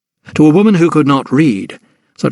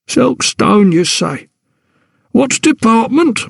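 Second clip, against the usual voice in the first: pompous porter; 10 semitones higher, slower than usual. Acted. Porter questions her.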